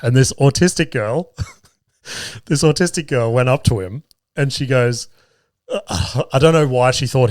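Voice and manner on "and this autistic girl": ordinary talk, nothing marked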